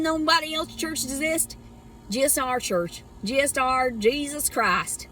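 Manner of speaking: put-on Southern churchgoer; smug church satire in a Southern accent.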